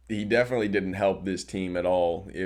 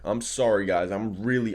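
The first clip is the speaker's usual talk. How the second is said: ordinary talk, nothing marked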